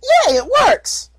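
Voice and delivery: high, slow, squeaky filly cheer; delighted little filly voice; filly Puppy. Acted. Little filly thrilled her rock floats, high filly voice.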